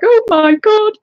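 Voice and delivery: high, loud panicked gasp of words; sudden alarm at the time; shocked exclamation. Retold or imitated. Hearing she has twenty minutes left, a high, loud panic. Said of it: "And I was like".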